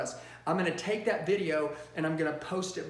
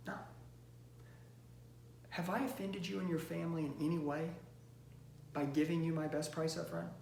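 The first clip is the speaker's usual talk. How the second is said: empathy close; 8 dB quieter than usual. Shown as a demonstration. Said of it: "what does an empathy close look like? It's like this".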